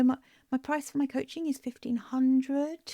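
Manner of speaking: hesitant price quote, rising on "hundred"; unsure upward inflection. Shown as a demonstration. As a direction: test their reaction, "hundred" left hanging like a question. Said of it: "you're so unsure, you're testing what their reaction's".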